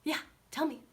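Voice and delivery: perky, willing, high little replies; attentive dog. Retold or imitated. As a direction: voice a dog awaiting instructions. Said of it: "look up at us like".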